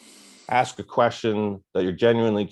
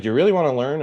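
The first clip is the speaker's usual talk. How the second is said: higher, louder challenge; a hint of gruff manager; 6 semitones higher, 4 dB louder, faster than usual. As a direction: test the rookie, lightly voiced. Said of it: "And he's like".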